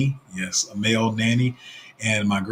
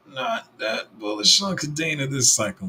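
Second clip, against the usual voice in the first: ordinary talk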